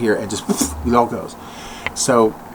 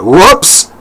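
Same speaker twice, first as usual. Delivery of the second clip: louder startled exclamation; whoops; 6 semitones higher, 8 dB louder than usual. Real, not acted. When his phone goes off, a louder startled whoops. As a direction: blurt it as the phone sounds. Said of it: "That was the phone".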